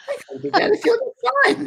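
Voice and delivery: very high, quick Scottish protest; Scottish accent. Retold or imitated. Scottish lady argues with little girl, high quick Scottish accent. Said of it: "I'm trying to my Scottish accent".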